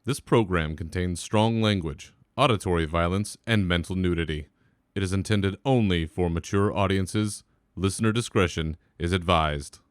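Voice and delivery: announcer voice. Acted. Reads a content warning.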